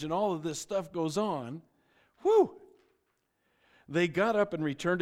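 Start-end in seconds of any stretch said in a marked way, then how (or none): 2.2-2.5 short high loud "whew"; excited whoop. Real, not acted. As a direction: let out a high, sudden whoop. Preacher's excited exclamation mid-sermon.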